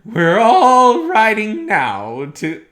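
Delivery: loud, very high lisping voice, drawn out; high falsetto old comedian. Shown as a demonstration. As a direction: crow it out like a giddy old comic. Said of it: "a little high, a little falsetto".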